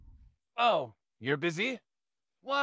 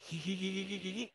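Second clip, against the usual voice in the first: giggle; 9 dB quieter than usual. Acted. Teasing the neighbor.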